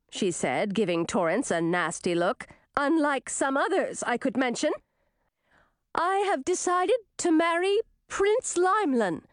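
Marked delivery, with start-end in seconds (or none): 2.8-9.2 high, pointed princess voice; high-pitched regal proclamation; haughty announcement. A narrator's reading. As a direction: declare it grandly after a snide dig. Princess announces choice, snubbing another suitor.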